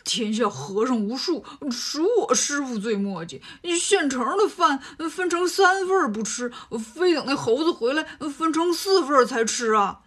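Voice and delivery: a greedy pig-monk's put-on voice, sulky, with a laugh; grumbling Bajie. Bajie grumbles at his master, sulky and greedy. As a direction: grumble like a hungry pig demon. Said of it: "埋怨说".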